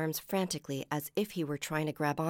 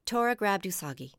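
ordinary delivery, nothing marked

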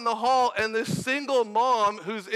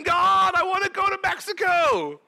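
high, raised plea to God; pleading cry; 6 semitones higher than usual. Retold or imitated. Dreamer begs God for the Mexico trip, pleading cry. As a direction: beg it out, high and strong.

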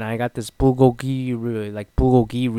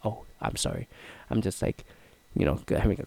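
breathy unvoiced apology, much softer; whispered aside; 5 semitones lower, 10 dB quieter, faster than usual. Real, not acted. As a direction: drop to a whisper for a quick sorry.

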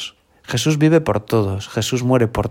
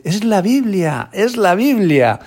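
higher, slow, drawn-out cries of joy; overjoyed child; 8 semitones higher, much slower than usual. Retold or imitated. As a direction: sing it out like a thrilled six-year-old. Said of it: "repetía una y otra vez".

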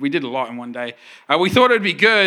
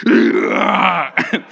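lion roar; 3 semitones higher, 6 dB louder than usual. Acted. [name] in lion suit roaring.

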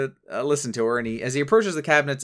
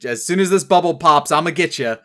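louder, higher playful menace; mock threat; 5 semitones higher, 7 dB louder than usual. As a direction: act out a threat, pushing the volume up. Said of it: "like, threateningly waves it".

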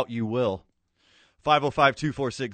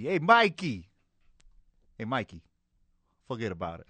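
hint of a New York Italian tough guy. Host greeting Mikey like a lightly voiced New York wiseguy.

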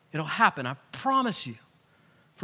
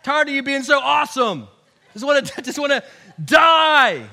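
acted exasperated complaint to God, raised pitch; fed-up, dramatic tantrum building to a loud last word; so mad; 3 semitones higher, 6 dB louder than usual. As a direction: whine angrily at God, drawing out the ends. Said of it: "He's so mad".

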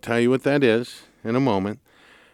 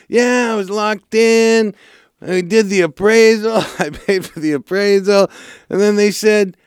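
upset client; 10 semitones higher, 5 dB louder than usual. Retold or imitated. Host imitating his client, higher and louder, complaining she was declined. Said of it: "She's all".

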